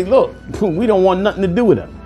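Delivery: normal talking voice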